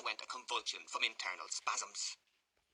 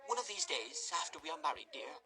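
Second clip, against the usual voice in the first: higher, coy lady's voice; coy matron; 6 semitones higher than usual. Acted. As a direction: promise it teasingly.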